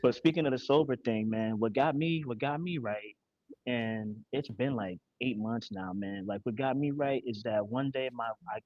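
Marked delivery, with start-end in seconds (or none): none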